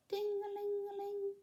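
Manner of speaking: high sung bell sound ding-a-ling; bell chime imitation. Girl wishing snowdrops rang, high chiming ding-a-ling.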